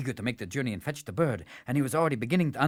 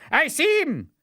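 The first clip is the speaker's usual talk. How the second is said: much higher, louder triumphant cry; excited shout; 4 dB louder than usual. Acted. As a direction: yell it like you just spotted your prey. Sharpshooter repeats, excited.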